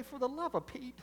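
a hint of a fed-up parent pleading; lightly voiced fed-up parent begging; exasperated plea. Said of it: "he might have said it like this".

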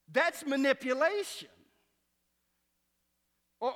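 loud, high, impassioned pulpit preaching; preaching at full force. Acted. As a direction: preach it out to the whole congregation.

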